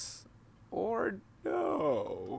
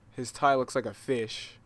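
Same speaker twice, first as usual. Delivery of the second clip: normal talking voice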